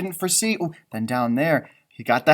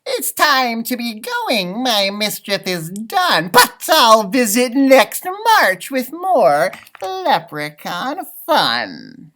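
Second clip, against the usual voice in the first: high, slow, louder cheeky leprechaun; leprechaun farewell; 6 semitones higher, 8 dB louder, much slower than usual. A narrator's reading. Leprechaun bids the class goodbye, high and slow. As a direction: tease them as you slip away.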